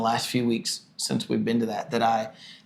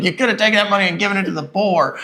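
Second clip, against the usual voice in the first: higher, louder, fast, laughing mock reproach; Judas Iscariot; 7 semitones higher, 5 dB louder, faster than usual. Husband mocking his own stinginess as Judas, high, loud, laughing. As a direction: lecture about the money like Judas, chuckling. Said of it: "I'm like Judas Iscariot".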